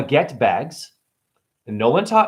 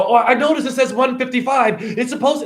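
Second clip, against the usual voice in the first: high, fussy customer voice; whiny customer; 10 semitones higher than usual. Retold or imitated. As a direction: mimic the customer griping at the register. Customer complaining about orange price.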